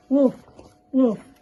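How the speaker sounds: loud woofs; dog barking. Acted. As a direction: bark it out.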